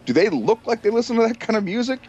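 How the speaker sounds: high-pitched incredulous questioning; exasperated rant. Retold or imitated. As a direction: hammer him with disbelieving questions, pitched high. Acted rant at a music fan.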